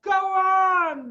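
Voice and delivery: high, loud call; monkey shouting. A narrator's reading. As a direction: shout up encouragement. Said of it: "the monkey shouted".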